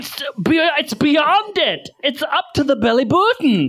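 high shout breaking into laughter; panicked line lost to giggles; laughing mock panic. Mower reaching her body.

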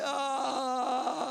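a groaning cry of waking pain; mock morning groan. Preacher mocking a stiff groan on waking. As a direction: groan like a stiff old body. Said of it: "first thing you want to do when you get up".

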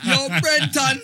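calls the name out loud through a laugh; laughing, calling out to a friend. Real, not acted. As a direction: shout his name while cracking up.

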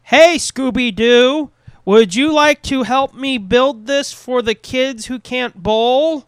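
slow, loud, wooden line read; stiff line reading. Retold or imitated. Mocking a basketball player's wooden acting, slow loud and stilted. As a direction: read it like a stiff athlete actor. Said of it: "that's pretty much how it sounded like".

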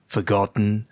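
ordinary talk, nothing marked

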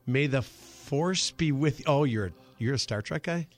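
ordinary talk, nothing marked

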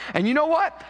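high, loud rising reveal; spring the surprise on them; excited question. Acted. Preacher teasing the punchline to the congregation, high and excited.

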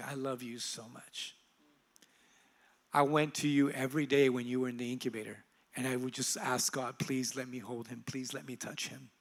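very soft, low, tender retelling; speaking love. Retold or imitated. Mother recalling incubator prayers to her son, softly loving. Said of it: "she just began to speak love over me".

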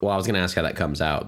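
ordinary talk

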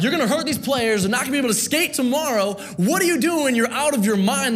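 panicked coaches pleading with the coach to stop; freaking out. Retold or imitated. Pastor voicing alarmed assistant coaches, high and pleading. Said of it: "the coaches are freaking out".

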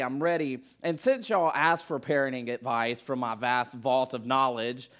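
ordinary delivery

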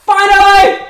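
high, loud triumphant yell; shout. Acted. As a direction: belt it out. Shouted ad climax.

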